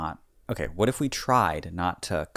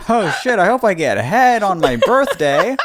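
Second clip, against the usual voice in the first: excited laughing mock; 11 semitones higher, 7 dB louder than usual. Imagined older self, laughing.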